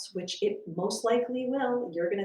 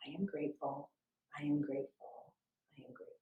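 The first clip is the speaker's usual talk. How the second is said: soft, fading to a near whisper; quiet inner repetition fading out; 5 semitones lower, 10 dB quieter, faster than usual. Shown as a demonstration. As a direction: say it softly, fading away each time. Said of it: "So quietly in your mind".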